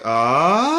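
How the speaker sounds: short ah sliding up in pitch; moving through range. Shown as a demonstration. Coach showing students how to slide through their range, rising ah. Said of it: "move through your range like".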